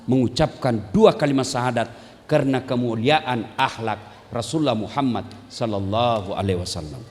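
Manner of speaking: normal talking voice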